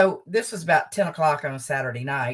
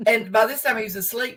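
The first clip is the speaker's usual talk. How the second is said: ordinary talk, nothing marked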